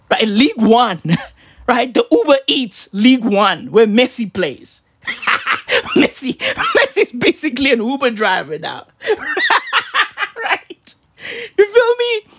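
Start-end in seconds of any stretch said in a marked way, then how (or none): 4.9-12.3 talking through real laughter; laughing. Real, not acted. Host joking about Messi as a driver, laughing through the words. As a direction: crack up while saying it. Cracking up at own Messi joke.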